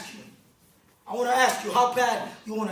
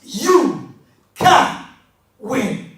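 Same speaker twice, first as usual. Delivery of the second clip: shouted, higher, slow and heavy; full-force preaching; 4 semitones higher, 7 dB louder, slower than usual. Acted. Motivational speaker drilling the audience on their dreams, shouting sermon. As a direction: hammer each question home to the room. Call and response with crowd.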